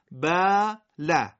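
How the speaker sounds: بله said very slowly with a stretched eh vowel; slow Arabic recitation. Shown as a demonstration. Teacher reciting بله slowly in Arabic accent.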